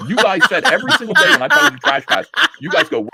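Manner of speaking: high, loud laughing complaint; half-laughing mock outrage at the fans; laughing exasperation. Real, not acted. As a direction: laugh it out, fed up with the audience. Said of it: "You guys asked for this".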